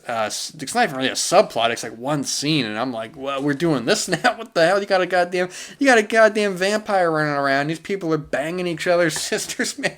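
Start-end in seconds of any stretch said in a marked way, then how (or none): 3.2-9.9 higher, laughing, incredulous tirade; pitched-up what-the-hell rant with laughs; exasperated mock rant. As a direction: rant at the absurd movie, half laughing.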